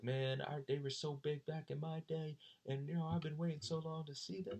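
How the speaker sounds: mildly voiced nostalgic Marine; a light impression of the older fan; Marine fan quote. A Marine in the crowd tells the speaker, lightly voiced. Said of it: "he was just like".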